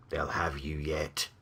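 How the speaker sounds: slow, very soft whisper; whispered threat. Acted. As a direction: whisper a slow warning to your master. Demon's menacing warning to master.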